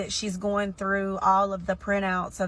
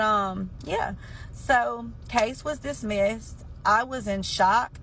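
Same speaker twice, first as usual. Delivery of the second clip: ordinary delivery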